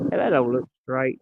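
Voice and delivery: usual speaking voice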